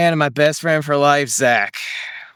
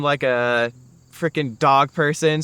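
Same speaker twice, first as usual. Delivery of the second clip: usual speaking voice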